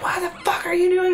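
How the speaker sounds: hammed-up screaming mom; mock mom scream. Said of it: "just screaming".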